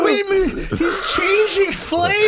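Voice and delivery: shrieked high and loud, drawn out slowly; high mock teacher shout. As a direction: shriek it like a horrified teacher, stretched.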